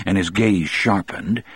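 plain reading voice

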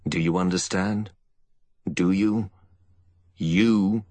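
rising fury, ending loud; angry demand. A narrator's reading. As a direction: drive each question harder. Furious avenger about to fire.

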